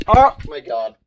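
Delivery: sudden high, loud startled cry; startled oh my God. Real, not acted. Streamer startled by a loud noise, yelps out loud. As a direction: jolt as the noise goes off.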